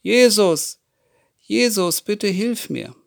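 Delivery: laut und höher rufen, flehend; weiter rufend. A narrator's reading. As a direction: ruf wieder laut und hoch nach Jesus. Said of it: "hört nicht auf zu rufen". (Bartimäus ruft trotz der Leute weiter laut nach Jesus)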